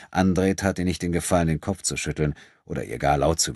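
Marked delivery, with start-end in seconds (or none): none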